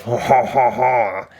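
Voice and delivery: mocking laugh in a put-on French accent. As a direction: sneer it like a smug Frenchman, French accent and all. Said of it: "Dude, that's a French accent".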